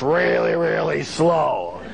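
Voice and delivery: slow right down to illustrate slowness; dragging the last words comically; drawn-out slowness. Shown as a demonstration. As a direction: stretch "really, really slow" out long. Acting out how slow garbage travels.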